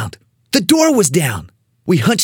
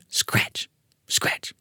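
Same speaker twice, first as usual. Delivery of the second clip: unvoiced scratch sound, hushed; soft, eerie scratch effect; whispered scratching; 9 dB quieter than usual. A narrator's reading. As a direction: whisper it like claws on wood. Narrator voicing scratching noise.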